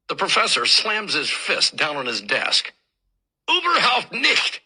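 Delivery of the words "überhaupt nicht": put-on high, loud, slow German outburst with a German accent; thundering German. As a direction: thunder it out like an angry German professor. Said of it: "he thunders".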